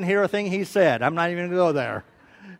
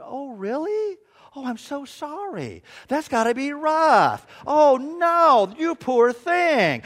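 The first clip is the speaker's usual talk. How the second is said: sympathetic wife; 6 semitones higher, 3 dB louder, slower than usual. Retold or imitated. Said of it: "Sandy's going".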